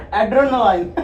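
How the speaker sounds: "adrenaline" sounded out as AD-ro-na-line; struggling pronunciation. Shown as a demonstration. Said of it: "I don't know how to pron".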